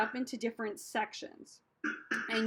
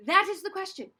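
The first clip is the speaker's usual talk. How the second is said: higher line, projected out; bold, raised-voice practice take; 5 semitones higher, 3 dB louder than usual. Shown as a demonstration. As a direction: punch it out as a rehearsal line. Drill with contrasting takes.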